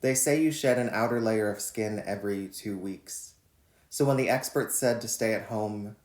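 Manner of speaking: measured, deliberate poem reading; poetry reading. Acted. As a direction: read each line like a spoken poem. Reads his poem Quarantine Lover.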